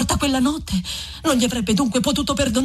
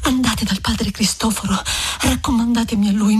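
Lucia pleading. Acted. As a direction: beg him gently with a pitched-up voice. Lucia begs Renzo.

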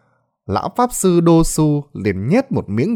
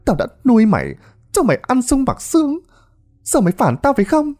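high, screeching abuse; betrayed woman screaming insults; furious cursing; 13 semitones higher than usual. Acted. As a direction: curse him from the floor. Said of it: "chửi".

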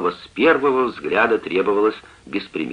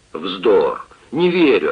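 angry retort; 5 dB louder, slower than usual. A narrator's reading. Father rejecting his daughter's plea, angry and loud. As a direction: bark out the disbelief. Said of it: "отвечал Сквайр".

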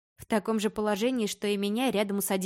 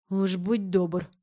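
snorting; much slower than usual. A narrator's reading. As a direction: scoff it out slowly. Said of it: "фыркнул".

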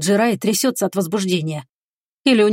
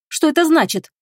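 much higher startled exclamation; exclaiming; 8 semitones higher than usual. A narrator's reading. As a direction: cry out as if surprised. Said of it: "восклицает она".

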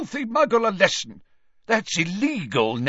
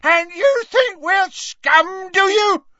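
very high, furious scream; 8 dB louder, slower than usual. A narrator's reading. Father screaming at the official, enraged. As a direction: scream it slowly and loudly in rage. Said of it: "screamed Gaunt".

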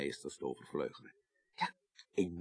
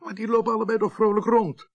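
higher and louder, taken aback; surprised protest; 14 semitones higher, 9 dB louder than usual. Acted. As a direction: blurt it out in disbelief. Protesting that both are alive. (verbaasd protest)

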